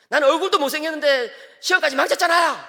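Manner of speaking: put-on high, loud sulky teen voice; whining son. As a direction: whine it like a hurt teenager. (징징대는 아들 목소리)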